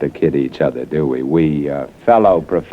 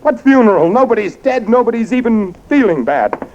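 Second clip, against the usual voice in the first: alarmed disbelief. Acted. Learns his own funeral is planned.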